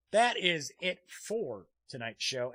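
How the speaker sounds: ordinary delivery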